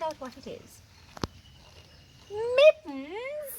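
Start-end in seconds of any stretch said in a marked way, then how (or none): 2.3-3.5 high, loud puzzled "Mittens?"; surprised Boa. Acted. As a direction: squeak it out in disbelief.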